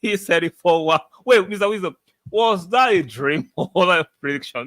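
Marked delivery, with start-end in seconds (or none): 1.2-4.7 amused teasing. Real, not acted. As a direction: tease him about his lucky guess. Teasing a viewer who predicted.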